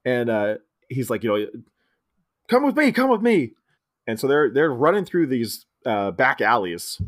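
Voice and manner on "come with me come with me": high-pitched put-on voice; urging guide. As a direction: hurry them along in a high voice. Imitating guide urging them to follow.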